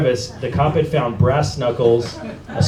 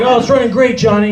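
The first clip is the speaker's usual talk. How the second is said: high, upbeat imitation of a pleased judge; chummy judge; 9 semitones higher, 4 dB louder than usual. Judge tells Johnny about the car he bought, chummy. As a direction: play the satisfied customer on the bench. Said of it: "And then he was like".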